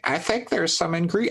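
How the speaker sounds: high, slow attempt at Donald Duck. Shown as a demonstration. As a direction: try a squawky cartoon duck voice. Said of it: "I can't do Donald Duck voice".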